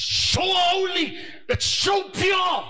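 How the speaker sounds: loud, high, slow proclamation; slow, loud preaching cry; preaching shout. Real, not acted. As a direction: proclaim it at full force. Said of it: "preacher shouts emphasis".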